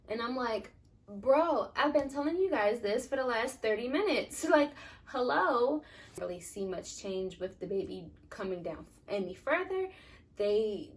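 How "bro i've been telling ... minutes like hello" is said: higher, louder mock-exasperated protest. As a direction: act out an indignant "told you so". Retold exasperation at the nurses.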